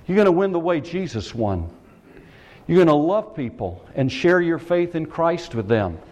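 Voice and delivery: ordinary talk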